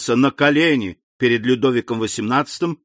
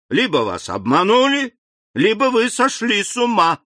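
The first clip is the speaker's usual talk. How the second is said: raging; 10 semitones higher than usual. Acted. King rages at minister.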